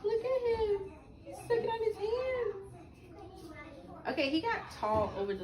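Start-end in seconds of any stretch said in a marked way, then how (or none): 0.1-2.5 higher cooing voice, sing-song. Real, not acted. Mother showing viewers her son, higher cooing baby talk.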